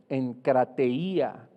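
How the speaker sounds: encrateia said very slowly, syllable by syllable, falling at the end; Greek word spelled out. Shown as a demonstration. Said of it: "con K y con I". (encrateia, muy despacio, sílaba por sílaba)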